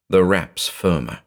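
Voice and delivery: ordinary delivery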